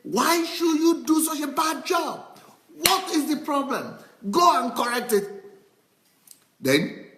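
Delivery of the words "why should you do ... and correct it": higher, firm mock scolding of a secretary. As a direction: boss scolding a sloppy job. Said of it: "I begin to scold her".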